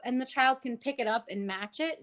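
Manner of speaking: usual speaking voice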